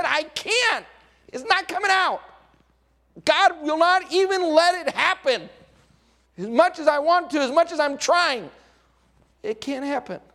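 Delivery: high, strained and loud, helpless protest; pleading prophet. Acted. Balaam protesting to Balak that he cannot curse, high and strained. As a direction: beg him to understand it won't come out.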